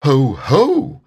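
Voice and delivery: loud, gloating ho ho; gloating Heffalump. Pretend. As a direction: gloat over the trapped ones.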